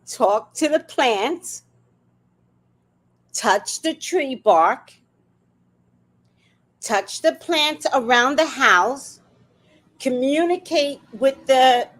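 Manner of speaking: slow, dreamy coaching; slow soothing spiritual counsel; slow mystical advice. Shown as a demonstration. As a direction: slowly coax them to commune with nature. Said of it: "I would start saying to homeowners".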